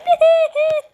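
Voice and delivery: short loud high laugh; high giggle. Real, not acted. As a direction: burst out giggling. Laughing after hair gone.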